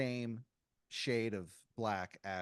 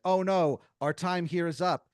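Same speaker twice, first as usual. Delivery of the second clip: higher, louder sudden alarm; urgent alarm; 6 semitones higher, 10 dB louder than usual. Acted. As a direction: warn that time has run out. Dream visitor's time runs out.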